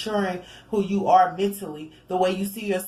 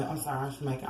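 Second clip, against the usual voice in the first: mouth full; 5 semitones lower, 8 dB quieter, faster than usual. Real, not acted. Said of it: "I'm chewing".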